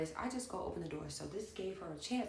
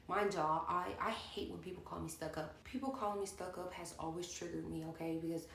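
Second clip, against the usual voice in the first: ordinary talk, nothing marked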